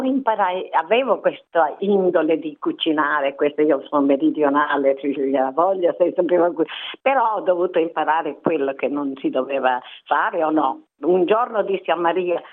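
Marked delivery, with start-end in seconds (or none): none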